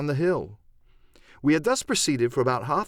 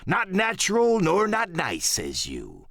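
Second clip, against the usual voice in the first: Silver, ominous pirate leader; 4 semitones higher, slower than usual. Acted. Silver warning his messmates about Flint, slow swooping pirate voice. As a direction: roll out a grim pirate warning.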